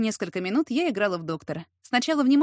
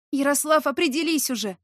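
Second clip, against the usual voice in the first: raised, exasperated mild moan; moaning plea; 5 semitones higher, 3 dB louder than usual. A narrator's reading. Heroine begs him to decide, lightly moaning. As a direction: moan it out, pleading. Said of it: "простонала я".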